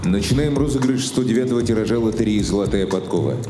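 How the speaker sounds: usual speaking voice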